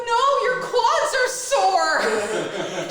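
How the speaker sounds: loud, high, drawn-out cry; mock tearful joy; hammed-up emotional reassurance. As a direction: wail the good news slowly. Retold tearful, joyful reassurance.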